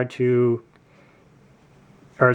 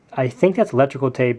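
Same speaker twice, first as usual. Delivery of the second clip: ordinary talk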